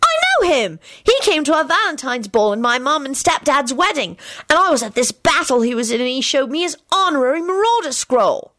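put-on high, eager little-boy voice; excited boy. Timothy boasts to Alex about knowing George, excited. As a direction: gush like a thrilled child.

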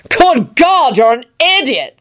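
high, emphatic outburst; exasperated bellow. A narrator's reading. Kingsley berates the guard, bellowing. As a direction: burst out at the fool. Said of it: "Kingsley bellowed".

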